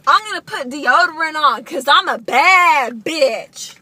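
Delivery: strut it out; sassy hype. Real, not acted. Hyping herself up before meeting Sailor.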